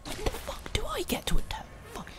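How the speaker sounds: whispered, exasperated complaint; frustrated whisper. Real, not acted. Gamer to the boss, frustrated whisper. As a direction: hiss the complaint under your breath.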